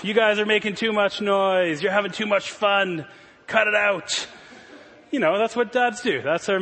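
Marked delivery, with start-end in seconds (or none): 0.0-4.3 mock stern dad. Dad scolding his noisy kids at bedtime, mock stern. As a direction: play the fun-killing father, half laughing. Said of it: "my job was to go in and squash all of their joy".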